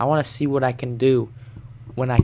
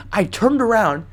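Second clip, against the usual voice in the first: ordinary talk, nothing marked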